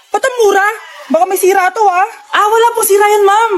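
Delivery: usual speaking voice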